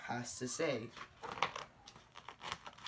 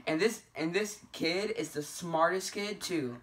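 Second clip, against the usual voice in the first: ordinary delivery, nothing marked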